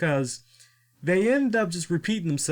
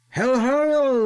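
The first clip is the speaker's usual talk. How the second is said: hello, hello, the second flung up high and loud; a wrestler's big sing-song greeting; mock hello; 10 semitones higher, 6 dB louder than usual. Mimicking the wrestler's loud hello.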